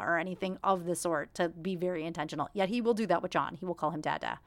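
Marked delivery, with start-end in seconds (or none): none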